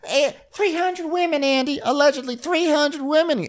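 high, slow mock Andy voice; hammed-up high Andy voice. Podcaster imitating Andy teasing, high mock voice. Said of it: "So now Andy is like".

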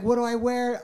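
high, panicky reenacted question; flustered. Husband retelling his scramble to get dressed, flustered. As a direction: play someone at a loss what to do. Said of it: "I didn't know what to do".

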